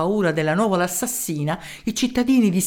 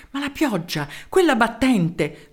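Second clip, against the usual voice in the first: ordinary delivery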